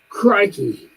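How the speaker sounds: quick high Australian "Crikey"; Australian crocodile hunter. Retold or imitated. Joking he is the cougar hunter, light Australian accent. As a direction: toss off a light Aussie exclamation. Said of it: "like the crocodile hunter".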